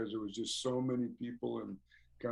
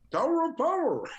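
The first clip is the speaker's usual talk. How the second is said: much higher, louder Russian fan; imitating the Russian fan; 6 dB louder than usual. Fan answering what brings him to New York, high imitation. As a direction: blurt the band name like the devoted fan.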